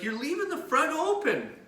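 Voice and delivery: pharmacist warning. Retold or imitated. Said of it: "suddenly George is like".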